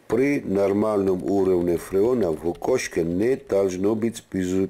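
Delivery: ordinary talk, nothing marked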